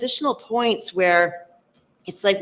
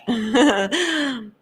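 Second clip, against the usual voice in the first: higher-pitched happy laugh; delighted laugh; 4 semitones higher than usual. Real, not acted. Host greeting a participant from Quebec, delighted laugh.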